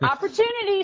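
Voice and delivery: a sudden high, excited cry of the word. Retold or imitated. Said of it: "So I'm like".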